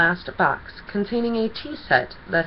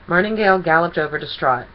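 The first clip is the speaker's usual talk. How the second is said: usual speaking voice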